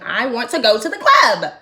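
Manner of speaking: loud, rising plea to go clubbing; insistent retold demand. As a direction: build to a mock holler. Acted insistence to locals about club.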